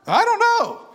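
high, loud, emphatic admission; loud exclamation. Retold or imitated. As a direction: shout it out proudly. Preacher's shouted answer to Sam.

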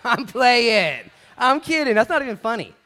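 high, loud laughing retraction. Real, not acted. Preacher laughing off his skier joke to the congregation, loudly. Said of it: "laughing after a joke".

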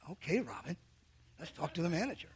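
a resigned, half-laughing aside; muttered reply. Retold or imitated. Wearily humoring friend's manager idea.

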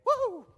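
high, joyful shout of praise; joyful whoop. Real, not acted. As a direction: let out a happy woo. Celebrating dance.

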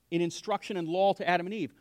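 usual speaking voice

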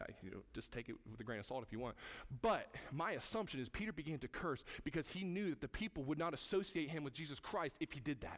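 ordinary talk

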